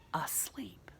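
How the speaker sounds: plain reading voice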